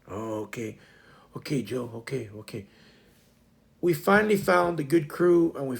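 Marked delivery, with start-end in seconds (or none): 0.1-2.6 soft, half-whispered meek replies; hushed, sheepish agreement; timid murmur. Retold or imitated. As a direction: shrink back and mumble okay. Timid employee answering boss.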